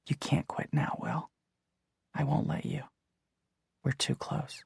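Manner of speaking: breathy close whisper. A narrator's reading. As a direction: whisper it into his ear. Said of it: "she whispered into my ear".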